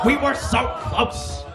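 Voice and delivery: laughing shout. Acted. Comic replaying the near-win, laughing shout. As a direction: wail it out through a laugh.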